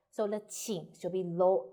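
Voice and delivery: ordinary delivery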